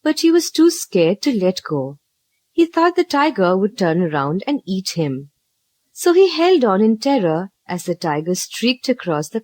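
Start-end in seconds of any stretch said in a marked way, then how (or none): none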